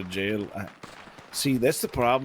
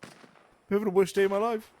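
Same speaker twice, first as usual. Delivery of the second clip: put-on New Zealand accent; 9 semitones higher, faster than usual. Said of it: "What a funny language".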